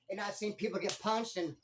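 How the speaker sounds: ordinary talk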